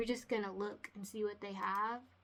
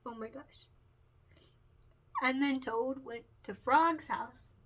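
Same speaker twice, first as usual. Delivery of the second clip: usual speaking voice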